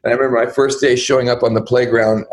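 normal talking voice

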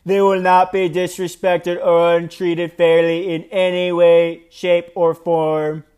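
slow, drawn-out politician impersonation; amateur impersonation in a deliberate, measured drawl; Donald Trump impression. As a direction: read it as a put-on presidential voice, stretching the words. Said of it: "I'm gonna read it in his voice".

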